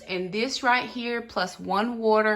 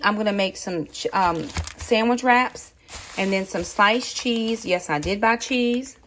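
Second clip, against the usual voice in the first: ordinary talk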